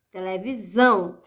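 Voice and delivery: "televisão" with a firm, held "são"; stress on final syllable. Shown as a demonstration. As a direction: punch the last syllable of "televisão". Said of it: "sílaba pronunciada com mais força". ("televisão" com o "são" forte e alongado)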